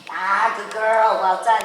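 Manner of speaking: slow, loud warm praise; drawn-out praise. Real, not acted. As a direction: stretch out the praise to the rider. Coach praising after effort.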